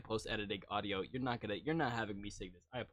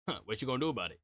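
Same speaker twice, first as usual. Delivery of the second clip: ordinary delivery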